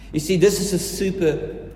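ordinary talk

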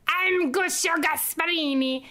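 imitating a schoolboy announcing himself; Guccio Gasparini. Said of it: "He'd be like".

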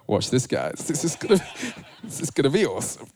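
chuckling conspiratorial aside to the camera; giggly mischievous whisper to the audience; cheeky aside. Retold or imitated. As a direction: laugh through it like sharing a prank. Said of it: "I can imagine Jesus turning to the camera".

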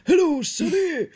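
put-on hammy old man greeting. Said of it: "old man voice".

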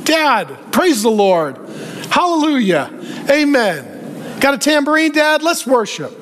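mock spiritual talk. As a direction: cover the lie with hammed-up praise. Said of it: "he starts talking very spiritually".

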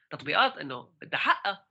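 ordinary talk, nothing marked

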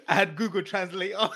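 giggling through words; laughing. Real, not acted. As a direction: laugh while confessing. Laughing at his own French.

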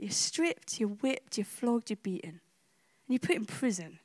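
soft, low listing of each suffering; sober, subdued invitation to imagine; hushed, solemn. Real, not acted. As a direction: lay each item down gently and low. Solemnly asks listeners to imagine it.

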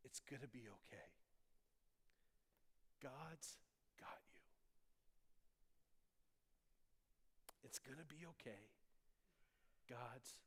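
softly, as if into someone's ear; retold whisper. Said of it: "she whispers into my ear".

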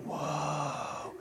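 long, very soft "whoa"; whispered mock astonishment; hushed awe. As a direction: breathe it out in wonder. Father awed at children sharing.

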